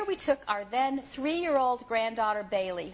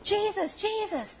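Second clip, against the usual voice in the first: higher, pleading call; lightly voiced desperate beggar; beggar crying out; 9 semitones higher than usual. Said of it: "screaming out in reckless abandon".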